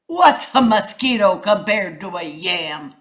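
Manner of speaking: grumpy snap. A narrator's reading. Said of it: "snapped the iguana grumpily".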